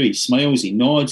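ordinary delivery

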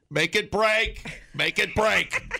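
high laughing urging; urgent urging. Pretend. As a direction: egg them on gleefully. Acting lab testers.

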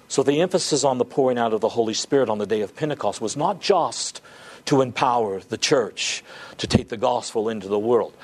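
normal talking voice

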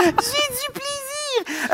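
parler bien plus haut en riant; fou rire. Real, not acted. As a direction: se laisser emporter par le fou rire. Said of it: "Je suis tellement décousu". (fou rire, voix bien plus aiguë)

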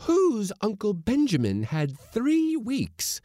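high, loud, drawn-out accusation; dark demand. A narrator's reading. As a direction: demand it slowly and menacingly in a high voice. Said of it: "demanded Missus Blossom darkly".

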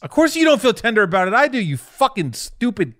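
high, loud, heated insult; mad. Real, not acted. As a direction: explode at the friend who shrugged it off. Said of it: "why you get so mad".

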